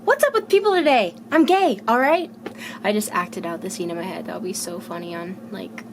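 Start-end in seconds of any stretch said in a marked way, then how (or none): 0.0-2.3 higher-pitched mock exasperation, lightly voiced; exasperated. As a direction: throw up your hands, high and incredulous. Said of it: "He's like".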